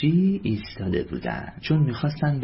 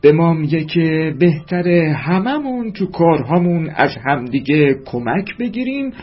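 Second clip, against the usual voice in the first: loud, higher fatherly voice; hearty father; 5 semitones higher, 9 dB louder than usual. A narrator's reading. Father teaching Mahbubeh about consulting, loud and proud. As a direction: explain it proudly like a big-voiced dad.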